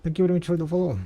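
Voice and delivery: bright, high and loud thank-you; excited thanks. Real, not acted. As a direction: burst out with a cheery thanks. Streamer thanks a new follower.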